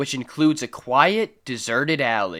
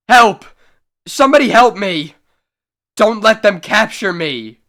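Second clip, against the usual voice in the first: loud, high desperate cries, quick; panicked screams for help; 7 semitones higher, 8 dB louder than usual. Acted. Adam Bentley screaming for strangers to save him, panicked shout. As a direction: yell for rescue in terror.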